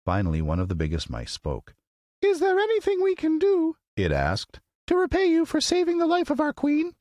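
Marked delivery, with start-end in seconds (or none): 2.2-6.9 very high, regal little squeak; proud mouse queen. Acted. Mouse queen to the Woodman, proud squeaky voice. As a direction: announce your royalty with tiny pride. Big mouse offering help.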